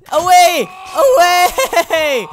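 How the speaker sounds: loud, high-pitched holler; excited shout. Pretend. Gamer calling out mid-game, excited shout. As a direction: shout it out, second one drawn out.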